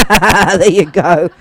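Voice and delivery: slow, laughing through the words. Real, not acted. Teacher laughing at her own laziness to students, laughing. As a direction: chuckle it out, very drawn out.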